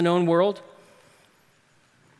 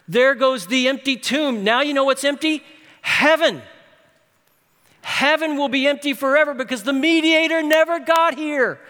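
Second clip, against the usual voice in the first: raised, higher, louder preacher's cry; soaring pulpit lament, higher and louder; preaching at full force; 6 semitones higher, 4 dB louder than usual. Acted. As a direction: preach it like the world is ending. Preaching climax on lost gospel.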